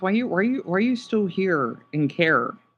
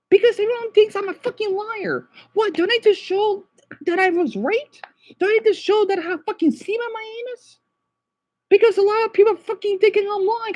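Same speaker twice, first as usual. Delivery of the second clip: high-pitched, distraught angry rant; real upset outburst, pitch way up; 12 semitones higher, 4 dB louder than usual. Real, not acted. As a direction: cry out in outrage, building to a shout. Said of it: "So I'm upset right now".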